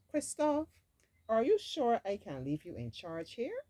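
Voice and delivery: sceptical big sister voice; doubtful Elsa. Acted. Elsa questions Christophe.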